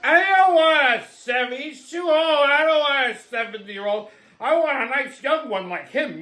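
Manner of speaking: put-on stretched, high-pitched old-lady voice; tuned-up older lady. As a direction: imitate a tipsy old broad complaining. Mimics tipsy older lady's complaint.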